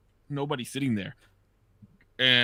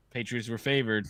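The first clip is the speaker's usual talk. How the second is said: ordinary talk, nothing marked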